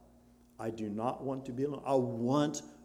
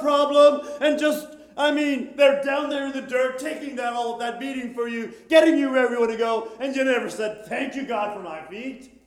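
raised, fervent pulpit challenge; fervent preaching, higher and louder; 11 semitones higher, 9 dB louder than usual. Acted. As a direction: press the question home to the people.

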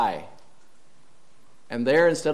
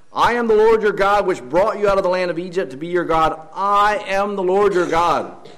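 booming proclamation; 4 semitones higher, 3 dB louder than usual. Acted. Preaching the repeated phrase.

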